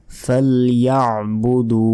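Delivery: ফালইয়াবুদু slow, throaty ain after ya, long final oo; chanted "fal-ya'-budoo" for ফালইয়াবুদু; correct pronunciation. Shown as a demonstration. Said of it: "আইনের উচ্চারণই তো".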